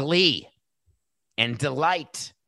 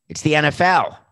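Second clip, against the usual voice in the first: louder, higher emphatic repeat; 6 semitones higher, 9 dB louder than usual. Retold or imitated. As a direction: punch it out with a loud higher push. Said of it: "and we said".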